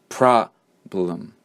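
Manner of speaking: "problem" split, PROB... lem, second part softened. Shown as a demonstration. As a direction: lean on the first half of "problem", let the second fade. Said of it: "the second syllable is quieter".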